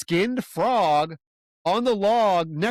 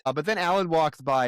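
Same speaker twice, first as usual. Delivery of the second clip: normal talking voice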